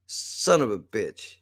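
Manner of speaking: normal talking voice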